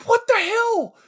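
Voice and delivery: sudden high-pitched disbelief; can't believe what you just watched; baffled outburst. Real, not acted. Host baffled by movie scene.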